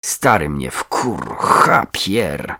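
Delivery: slow, low angry cursing; drawn-out, irritated swearing. A narrator's reading. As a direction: let the curse out heavily and slowly. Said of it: "rzuciła wiązankę".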